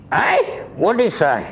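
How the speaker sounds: high startled "hey", half-shouted question; deaf old grandfather. Retold or imitated. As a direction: play the deaf old man who missed it.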